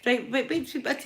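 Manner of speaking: ordinary talk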